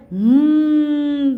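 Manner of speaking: long drawn-out rising hum like a rocket; rocket whoosh. Shown as a demonstration. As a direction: hum a rocket taking off, stretched and loud. Rocket going up for children. (ऊपर उड़ते rocket की लंबी गूंजती आवाज़)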